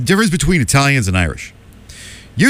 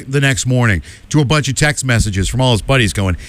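normal talking voice

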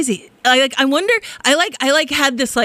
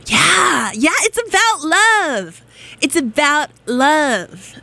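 sincere; 5 semitones higher, much slower than usual. Real, not acted. Closing on love, drawn out.

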